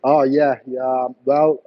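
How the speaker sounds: normal talking voice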